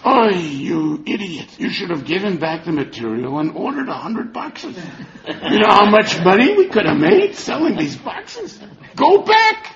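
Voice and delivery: loud, exasperated scolding; scolding wife. Acted. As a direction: shout at him like a fed-up wife. Wife scolds her husband.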